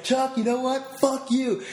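high, mock-confidential taunt; hammed-up drunk telling Chuck off; drunk stranger's jab. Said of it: "it's like".

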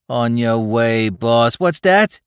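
breathless Bowery tough, worked up; Bowery boy voice. Acted. Spike surprised.

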